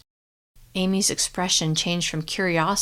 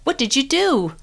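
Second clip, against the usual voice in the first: higher and louder accusing demand; furious; 7 semitones higher, 4 dB louder than usual. A narrator's reading. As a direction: confront her angrily. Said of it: "look even more furious".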